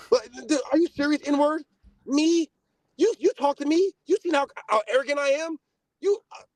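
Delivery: high, rising disbelief; incredulous outrage. Real, not acted. Incredulous outburst at Mark.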